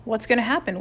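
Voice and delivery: ordinary delivery, nothing marked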